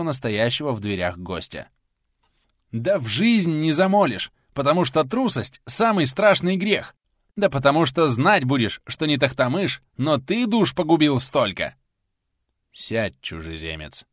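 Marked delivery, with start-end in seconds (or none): 2.7-11.7 furious outburst. Acted. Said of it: "не на шутку разошёлся". 12.7-14.0 low, slow, firm command. Acted. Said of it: "властно молвил Сергий".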